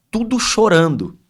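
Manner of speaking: moved emphasis. Real, not acted. Describing children all crying.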